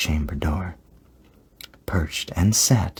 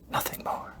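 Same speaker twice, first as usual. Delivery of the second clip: voiceless, softer whisper; hushed whisper; 9 dB quieter than usual. A narrator's reading. Narrator finding only darkness at the open door, whispered. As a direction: let the voice drop away into a whisper.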